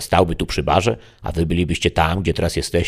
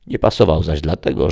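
ordinary delivery, nothing marked

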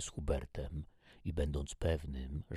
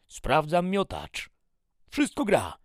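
shouted report; 11 dB louder than usual. Acted. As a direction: shout your status to the major. Startled soldier shouts back report. (głośny żołnierski meldunek)

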